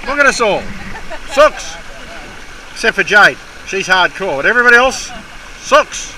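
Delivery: loud, high jeering shout; loud teasing. Real, not acted. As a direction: call them out, teasing at full volume. Loudly mocking the group as sooks.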